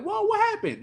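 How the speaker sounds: sudden high, startled question; jolted by the news, ask fast; surprised whoa. Real, not acted. Hearing a calm friend was furious.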